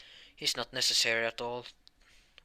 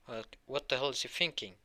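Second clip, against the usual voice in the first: plain reading voice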